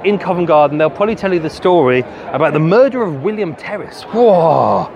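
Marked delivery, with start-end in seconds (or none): none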